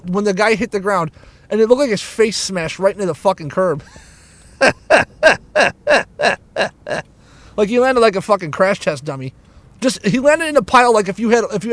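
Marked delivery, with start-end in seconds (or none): 4.6-7.0 wicked amusement at your own story; real laugh. Real, not acted. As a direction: run of staccato chuckles.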